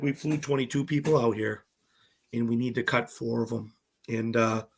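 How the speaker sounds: low, soft, halting delivery of news; grave, deadpan build-up; straight-faced producer. Retold or imitated. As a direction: imitate a producer stalling with a straight face. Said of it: "all straight face".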